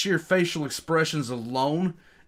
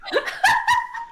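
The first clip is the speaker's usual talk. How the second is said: squealing laugh; high burst of laughter; 4 dB louder than usual. Real, not acted. Laughs at recoil joke.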